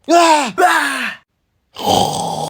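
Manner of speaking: high, drawn-out yell; crying out. Acted. Late child calling mother, crying out. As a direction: burst out in a high cry. Cries out between calls for mother.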